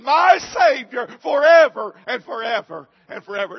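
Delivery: shouted preaching. Real, not acted. Pastor rallying the congregation toward heaven, shouting. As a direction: call it out to the whole church.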